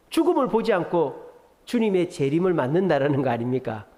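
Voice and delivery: normal talking voice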